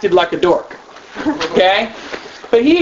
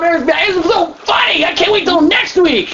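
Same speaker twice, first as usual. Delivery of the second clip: put-on gushing kid; 7 semitones higher than usual. Kids gushing about club.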